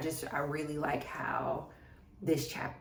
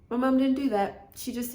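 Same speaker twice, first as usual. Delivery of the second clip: high, loud, emotional burst; close to crying; 7 semitones higher, 5 dB louder than usual. Real, not acted. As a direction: force it out past the tears. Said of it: "don't wanna be crying".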